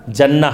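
"جنہ" said as "janna"; short a opening "جنہ"; zabar on jim. Shown as a demonstration. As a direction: say "جنہ" as "JAN-na". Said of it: "تینوں کے ساتھ".

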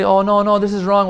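loud, high mock rebuke; mock scolding. The preacher imitating a harsh scholar who forbids. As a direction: bark out a pretend refusal. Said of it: "He doesn't say".

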